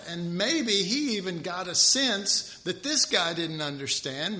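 normal talking voice

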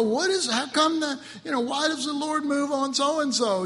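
high-pitched questioning complaint; complaining believer. Acted. Preacher voices folks questioning the Lord, complaining. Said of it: "We go".

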